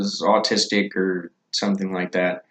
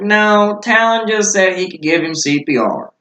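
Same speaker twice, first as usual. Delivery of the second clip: higher-pitched correcting reply; retold protest; 8 semitones higher than usual. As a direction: explain it insistently with raised pitch. Retells group's correction to the cop.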